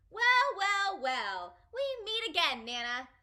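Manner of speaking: loud, high, gleeful greeting; happy holler. Acted. Child running to Nana, hollering happily. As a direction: holler it like a cheeky old friend. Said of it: "I hollered real happy".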